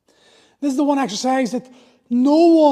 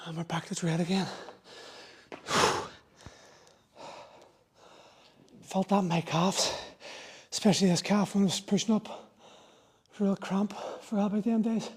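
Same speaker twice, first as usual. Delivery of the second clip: out of breath; 4 semitones lower, 8 dB quieter than usual. Real, not acted. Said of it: "how out of breath I am".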